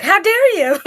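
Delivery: high-pitched playful indignation; mock outrage. Recruit forced to toss her things, mock outrage. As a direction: act affronted with a laugh in it.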